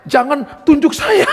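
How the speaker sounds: lightly voiced shaman begging off; shaman pleading. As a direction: imitate a beaten rival, drawn out. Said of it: "dia katakan".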